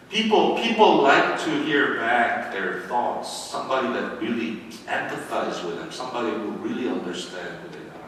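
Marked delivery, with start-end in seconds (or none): none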